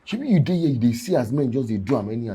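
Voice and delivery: heated protest. Acted. Man arguing his case to Rosie, heated protest. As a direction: protest loudly, pushing the point.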